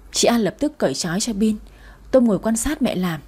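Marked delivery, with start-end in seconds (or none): none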